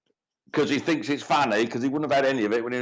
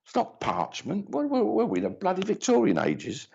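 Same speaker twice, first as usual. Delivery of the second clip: whispered aside; 7 semitones higher than usual. Real, not acted. Muttered self-correction to sitter.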